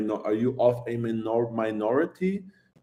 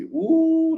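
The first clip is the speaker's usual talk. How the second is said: long, high, loud "ooh" of relish; high-pitched appreciative whoop; delighted ooh; 5 dB louder than usual. Real, not acted. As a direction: draw out a delighted, soaring ooh. Relishing the memory of a whiskey.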